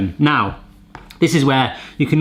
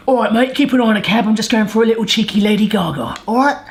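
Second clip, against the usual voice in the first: put-on cheeky Cockney accent, higher and quick; quick, cheeky Cockney, pitched up; 6 semitones higher, faster than usual. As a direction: play a chirpy Cockney geezer. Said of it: "in Cockney rhyming slang".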